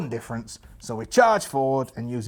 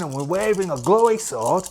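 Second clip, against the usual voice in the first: normal talking voice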